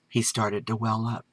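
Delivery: ordinary delivery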